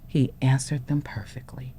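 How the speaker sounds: hushed, awed whisper; soft whispered payoff. Real, not acted. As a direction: whisper it like a proud secret. Proud quiet payoff.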